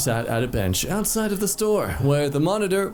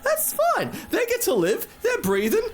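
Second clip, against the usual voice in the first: mock cheerful. Mocking the post author's logic.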